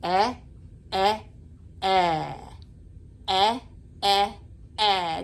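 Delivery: short e sound repeated, clearly shaped; vowel sound demo. Shown as a demonstration. Teacher showing a child the letter E sound, deliberately. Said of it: "and it says".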